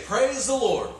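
high, bright call of Praise the Lord; old lady praising. Retold or imitated. Old lady greets the morning sun, calling up high. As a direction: call it up to the sky.

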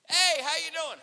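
loud, high cheery greeting; overly friendly. Retold or imitated. Cashier greeting a customer who walks in, all friendly. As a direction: boom out a big friendly hello. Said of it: "he gets all friendly".

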